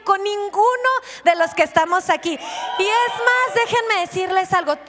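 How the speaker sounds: fiery preaching peak; preaching shout. Real, not acted. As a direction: lift it to a shout. Preacher's climax.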